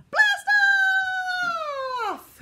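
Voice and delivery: high, loud, drawn-out yell; launch shout. Pretend. Leader blasting off with children, high long shout. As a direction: shout it upward like a rocket launching.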